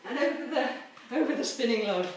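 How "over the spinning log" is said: ordinary talk, nothing marked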